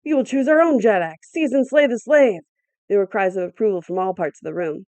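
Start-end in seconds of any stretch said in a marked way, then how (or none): 0.0-2.4 loud, higher, slowing defiant cry; defiant shout. A narrator's reading. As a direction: hurl the order at the crowd. Warriors reject Igos' claim.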